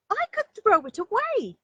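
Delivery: high, loud little bunny voice; a small child bursting to show off her tooth; excited little rabbit. Acted. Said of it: "said Little Rabbit".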